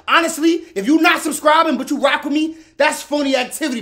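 loud, high hype pitch; excited. Real, not acted. Hyping viewers to subscribe.

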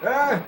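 sharp high yelp of surprise; startled yell. Real, not acted. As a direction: cry out as you get hit. Sudden death in game.

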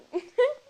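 short, high, loud giggle. Real, not acted. Girl giggling at her own vlog intro. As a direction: giggle at yourself. Laughs after "vlog one.

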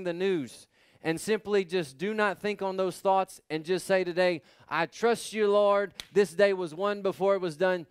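loud, high, driving sermon delivery; full-force preaching. Acted. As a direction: preach it out to the congregation.

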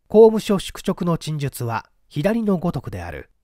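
ordinary delivery, nothing marked

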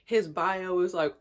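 ordinary delivery

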